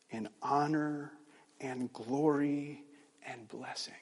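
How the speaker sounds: slow, reverent recital with long pauses; solemn scripture recitation. Acted. Preacher reciting praise of the Lamb to close the sermon, solemn and slow. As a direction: let each word of praise stand alone, unhurried.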